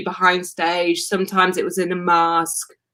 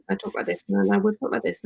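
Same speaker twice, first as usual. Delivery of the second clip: softer, shrinking, barely audible; nervous stage-fright mumble; 7 dB quieter, much faster than usual. Shown as a demonstration. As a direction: swallow the words like a frightened student. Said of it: "I used to talk like this".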